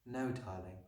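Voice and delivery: soft, soothing reassurance; quietly calm an upset child; gentle teacher. A narrator's reading. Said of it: "Miss Honey said".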